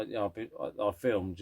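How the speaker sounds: ordinary talk, nothing marked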